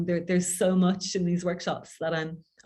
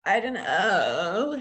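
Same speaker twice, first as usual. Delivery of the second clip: throaty vocal fry; much slower than usual. Shown as a demonstration. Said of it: "it's a bit more throaty than that".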